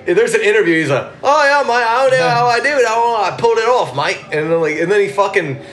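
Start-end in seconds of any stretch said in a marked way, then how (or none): 1.2-4.2 high, hammed-up Cockney; British accent. Imitating the actor in an interview, broad British accent. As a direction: imitate a chirpy Brit at higher pitch. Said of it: "He's British as fuck".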